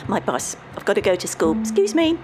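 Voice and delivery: flustered excuse. Acted. Boy hurriedly escapes to his bus.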